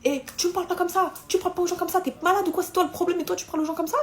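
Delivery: high-pitched mock scolding; telling off the taxi driver, mock outrage; retold telling-off. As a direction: scold him like a rude child. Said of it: "je commence à hurler aussi".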